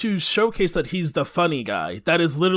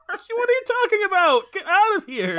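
much higher, laughing mock dismissal; laughing rebuff. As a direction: shoo him off, cracking up. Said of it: "acted girl's rebuff".

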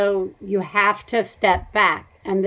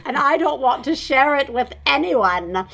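hammed-up delight, stretching the words out; mock gleeful child; 6 semitones higher than usual. Parent imagining kids happily wandering off bored, mock gleeful.

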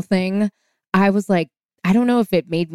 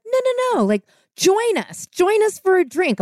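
much higher, sweetly pleading voice; high insisting wife; 12 semitones higher than usual. Retold or imitated. Wife presses Eddie to stay, in a much higher pleading voice.

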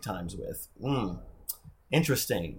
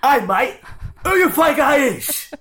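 loud, high, slow London tough guy with a laugh; London accent; 13 semitones higher, 8 dB louder than usual. Retold or imitated. Friend hamming up a London accent, laughing. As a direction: play the cocky London lad. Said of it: "kind of thing".

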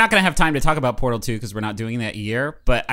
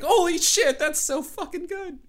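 retold cheering; 4 dB quieter, slower than usual.

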